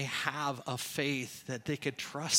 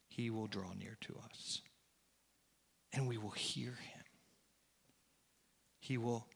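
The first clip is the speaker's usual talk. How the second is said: low, soft sermon voice with long pauses; hushed preaching; 6 semitones lower, 7 dB quieter than usual. Real, not acted. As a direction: bring it down to a reverent hush. Preacher drops to reverent hush.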